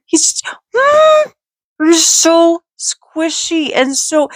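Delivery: high excited squeal with a gasp; delighted gasp. Real, not acted. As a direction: overcome with cuteness. Overwhelmed by cute ducky.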